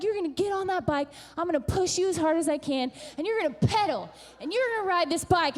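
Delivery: high, fast rallying push; fired-up pep talk. Retold or imitated. Mother promises to push her daughter, fired up. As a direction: rattle it off like a coach.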